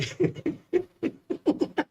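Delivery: airless high laugh through the nose; breathy silent laugh. Real, not acted. Recalling his school fight, a breathy silent laugh. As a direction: let a soundless laugh escape.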